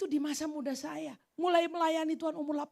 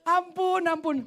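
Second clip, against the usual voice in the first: ordinary delivery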